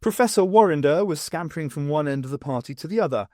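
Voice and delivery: plain reading voice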